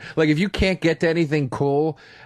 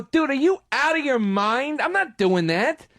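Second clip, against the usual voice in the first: retold yelling; 11 semitones higher than usual. To the airline desk agent, high exasperated yelling. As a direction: yell it at the desk agent in disbelief.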